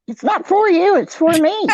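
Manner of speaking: high, loud teasing protest; playful insistence. Real, not acted. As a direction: insist playfully that it's for you. Teasing demand for cornbread.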